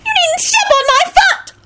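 very high, squeaky elf voice; high-pitched indignant screech; angry elf shriek. A narrator's reading. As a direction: shriek like an outraged little elf. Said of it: "shrieked an angry elf".

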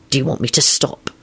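hushed, understated desperation; desperate. A narrator's reading. Lupin asking whether to stop, quietly desperate. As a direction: ask with restrained urgency. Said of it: "in a desperate sort of voice".

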